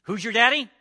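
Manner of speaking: loud, high "Who's your daddy?"; playful shout. Acted. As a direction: toss out the phrase loudly. Preacher shouts playful phrase.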